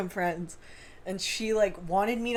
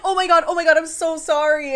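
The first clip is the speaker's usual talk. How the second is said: frantic apology; 7 semitones higher, 7 dB louder than usual. Retold or imitated.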